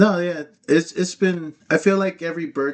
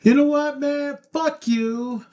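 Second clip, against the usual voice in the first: high-pitched mock defiance; 8 semitones higher, slower than usual. Tourist retelling his reply to the hustler, mock defiance. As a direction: tell him off in a raised pitch, half joking.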